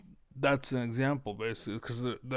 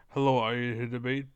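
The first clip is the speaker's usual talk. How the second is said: brisk, loud mimicked greeting; quick imitation of an opening question; imitating a caller; 5 dB louder, faster than usual. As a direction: hurry through it loudly as the mimicked caller. Repeats the re-enacted greeting.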